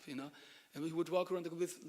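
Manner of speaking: usual speaking voice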